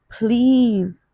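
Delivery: begging. A narrator's reading. Addict begs the dealer, high pleading please. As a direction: beg him with everything. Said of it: "I begged the dude".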